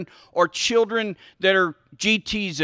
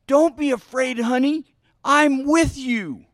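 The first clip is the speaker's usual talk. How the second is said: reassuring parent; 5 semitones higher than usual. Pretend. Parent comforting a scared kid, acted reassurance. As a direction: soothe a frightened little child, pitched up. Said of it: "but differently".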